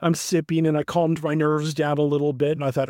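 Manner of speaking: ordinary talk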